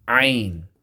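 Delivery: ain squeezed from the middle of the throat; throaty ain. Shown as a demonstration. Teacher naming the letter ain for students. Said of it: "going down deeper into the throat".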